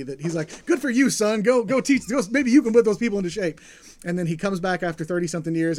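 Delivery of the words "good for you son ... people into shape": higher, louder cheering on his son; happy proud father. Retold or imitated. God cheering Jesus on, higher and louder. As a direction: send him off with big fatherly encouragement. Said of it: "God is, like, happy".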